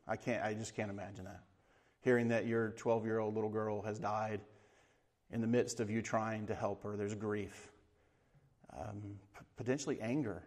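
drops to a near hush over the grief; quiet and heavy, as if the thought is hard to say. Real, not acted. Preacher dwelling on grief, voice drops.

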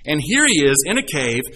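ordinary delivery

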